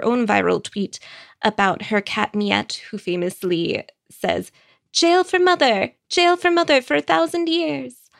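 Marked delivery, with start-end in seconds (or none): 4.9-8.1 put-on high squeaky French-accented cat voice; a little French cat, squealing its verdict. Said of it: "in a little cat French accent".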